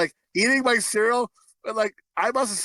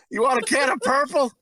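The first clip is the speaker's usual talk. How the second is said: shouted movie quote; 6 semitones higher than usual. Retold or imitated. Quoting the movie to friends, hammed-up shout. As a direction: belt the line like the character.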